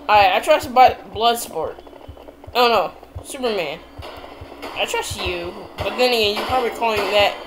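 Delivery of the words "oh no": ordinary delivery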